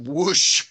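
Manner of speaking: breathy rush of fire sound; imitated whoosh. Game master as the trap sets off fire, whooshing. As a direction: make the word sound like igniting fire.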